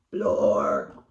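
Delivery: loud rasped monster word; creature noise. Acted. The something answers Sally with a loud raspy creature noise. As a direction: spit out a creature sound. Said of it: "said the something".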